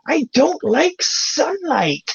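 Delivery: high slow witchy croak; witch voice. Retold or imitated. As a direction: play the old witch, slowly. Mim quoted, acted voice.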